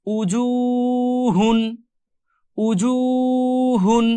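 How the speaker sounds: উজুহুন slowly recited with the জু held long; slow recited demo. Shown as a demonstration. Teacher reciting উজুহুন for students, slow chanted demo. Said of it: "জিম ওয়া পেশ জু".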